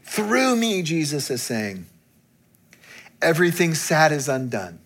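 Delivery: rising, high proclamation; preacher building the sermon's close, high; impassioned preaching. Acted. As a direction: lift the room with each line. Preaching climax continues.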